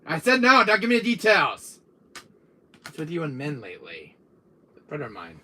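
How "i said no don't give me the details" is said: loud, high protest; worked-up, raised-voice scolding; exasperated refusal. Acted. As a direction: cut your brother off loudly. Refusing to hear the details.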